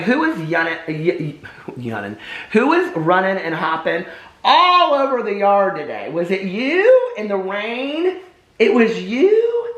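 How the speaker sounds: put-on high, slow sing-song baby talk with lisped words; baby talk to dog. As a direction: tease the dog in a cooing baby voice. Owner cooing to her dog.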